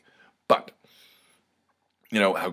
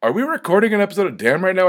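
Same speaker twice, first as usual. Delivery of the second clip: much higher, louder and fast mock-incredulous; mock friend's voice; 12 semitones higher, 7 dB louder, faster than usual. Friend asking if this is an episode, high fast mock disbelief. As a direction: squeak it out as his friend in disbelief. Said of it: "she goes".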